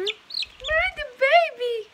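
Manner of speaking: very high, slow cooing. Real, not acted. Girl cooing at a baby chick, very high and slow. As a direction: coo at the tiny chick.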